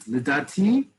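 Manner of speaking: LIDATI rising on its last syllable; up at the end. Shown as a demonstration. Teacher demonstrating humility to learners, LIDATI going up at the end. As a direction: end LIDATI on a humble upward lift. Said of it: "go up a little at the end".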